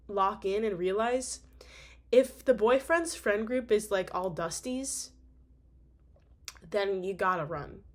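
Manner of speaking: slow, deliberate warning; slow emphatic. Real, not acted. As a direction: spell it out slowly.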